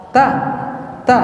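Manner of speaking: normal talking voice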